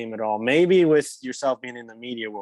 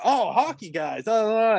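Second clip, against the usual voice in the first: imitated delighted change of mood, pitched up; suddenly friendly; 9 semitones higher than usual. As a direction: act out perking up at a new discovery. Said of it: "mood and stuff on his face changed like that".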